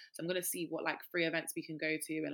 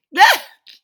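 high shrieking laugh; loud laugh; 21 dB louder than usual. Real, not acted. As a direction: burst out laughing loudly.

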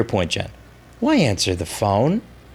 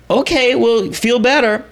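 higher, chirpy goodbye; bright fake-cheerful; 10 semitones higher than usual. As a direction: sound cheerful while disappointed. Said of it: "a legit dramatization".

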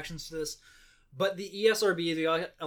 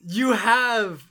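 ordinary talk

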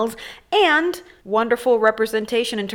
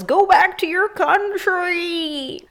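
high, drawn-out heckler's yell; mock yell; 8 semitones higher, much slower than usual. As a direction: imitate a street heckler, stretching the words. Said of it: "somebody yells".

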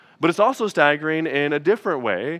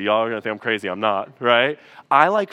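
ordinary delivery

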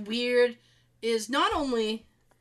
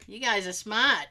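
ordinary delivery

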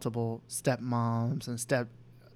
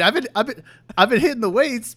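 loud; 10 semitones higher, 10 dB louder than usual. Real, not acted. Joking about his muscles.

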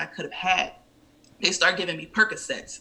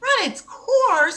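very high, loud, drawn-out echo; incredulous; 6 dB louder, much slower than usual. Retold or imitated. Patient echoing doctor's advice, incredulous. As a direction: throw the phrase back in outraged disbelief. Throws doctor's phrase back.

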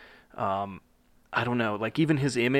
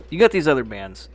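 ordinary talk, nothing marked

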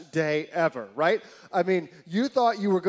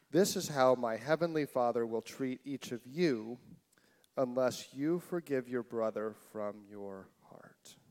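soft solemn reading; 6 semitones lower, 7 dB quieter than usual. Real, not acted. Closing scripture verse read softly.

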